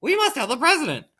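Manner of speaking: high, urgent chicken voice; clucking hen. A narrator's reading. Hen insisting the president be told, clucking high. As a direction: squeak it out like an alarmed hen. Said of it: "clucked Chicken Licken".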